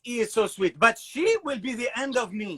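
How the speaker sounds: put-on high, fond French accent papa sighing over his daughter; mock French father, doting but doomed; doting French dad. Father teasing about his twins, in a doting French accent. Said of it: "Be like".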